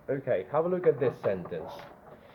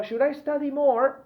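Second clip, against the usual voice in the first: high, louder student question; imitating a student; 13 semitones higher, 7 dB louder than usual. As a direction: play the eager student asking. Teacher voices a student asking.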